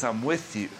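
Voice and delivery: a hint of the shepherd calming a frightened sheep; gentle reassuring shepherd. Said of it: "the voice of your shepherd speaks peace".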